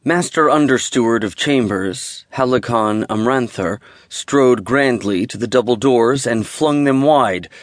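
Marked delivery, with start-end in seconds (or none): none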